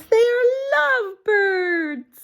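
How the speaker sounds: high, slow, dreamy coo; Piggy gushing about lovebirds; swooning Piggy. Acted. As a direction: coo it like you're charmed. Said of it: "Piggy says".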